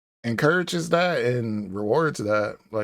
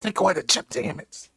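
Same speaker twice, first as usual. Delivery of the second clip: stage whisper; 4 semitones higher than usual. Pretend. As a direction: whisper it so the mic catches it. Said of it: "You probably should stage whisper".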